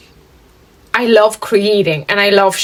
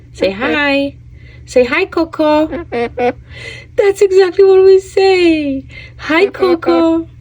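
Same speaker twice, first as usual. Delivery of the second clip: high coaxing pet voice; 8 semitones higher than usual. Real, not acted. Owner coaxing her bird Coco to say hi, high and coaxing. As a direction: coax the bird to copy you, up high.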